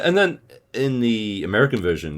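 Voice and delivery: usual speaking voice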